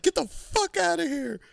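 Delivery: high shrill mock yell; high furious shriek. Landlady chases tenants away, high mock shriek. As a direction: screech them off the property. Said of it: "And she's like".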